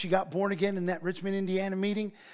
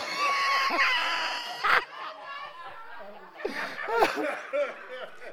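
big high laugh. Real, not acted. Crowd of memories, big laugh.

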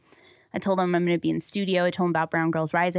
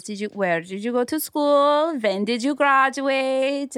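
higher, drawn-out mock Middle Eastern accent; 5 semitones higher, slower than usual. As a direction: mimic a questioning driver with a Middle Eastern accent. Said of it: "I can't even do the accent".

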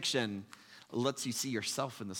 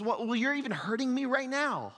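much higher, louder whining complaint; ghost man complaining; 11 semitones higher, 5 dB louder than usual. Retold or imitated. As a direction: protest that it hurts. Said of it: "He says".

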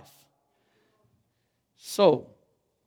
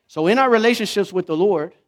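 ordinary talk

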